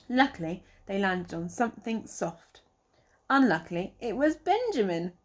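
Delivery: plain reading voice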